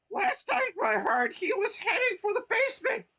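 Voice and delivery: very high, loud crying shout; trapped Toad shouting where Mario went; urgent Toad. Acted. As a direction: squeal it out desperately.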